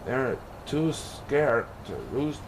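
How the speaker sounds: ordinary delivery, nothing marked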